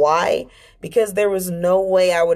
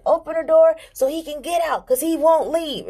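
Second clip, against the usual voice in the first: put-on much higher, insistent child voice; a little kid explaining to her dad; high pleading toddler tone; child's voice; 11 semitones higher than usual. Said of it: "I said".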